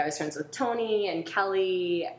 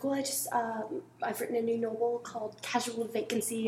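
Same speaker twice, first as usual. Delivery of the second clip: higher, softer British voice; a light posh British accent; 5 semitones higher, 4 dB quieter than usual. Retold or imitated. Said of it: "could sort of do a British accent".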